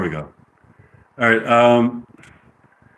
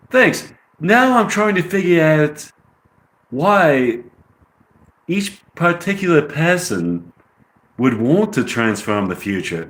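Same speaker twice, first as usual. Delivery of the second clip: higher-pitched British accent; 6 semitones higher than usual. Shown as a demonstration. Rehearsing lines in a British accent. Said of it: "I'll say this sentence with a British accent".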